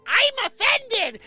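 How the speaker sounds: very high, loud, drawn-out mocking whine; hammed-up high squeal of offense; mock offended. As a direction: imitate an outraged complainer, shrieking it slowly. Mocks an offended audience member.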